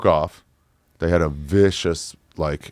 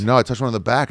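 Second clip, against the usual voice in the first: ordinary delivery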